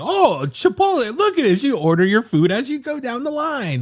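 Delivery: high, wide-eyed tourist gushing; impressed tourist. Retold or imitated. Tourist gushing over the burrito line. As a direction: gush like a dazzled foreign tourist. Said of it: "tourists here are like".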